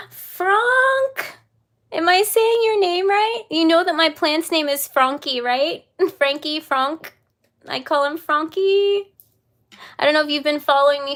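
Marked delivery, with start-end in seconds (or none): 0.1-1.4 high, loud delighted call of a name; excited call. Real, not acted. To viewer Frank, a real delighted high shout. 1.9-9.1 high, loud, giggly gushing; excited gushing. Real, not acted. Telling viewer her plant shares his name, real giddy excitement.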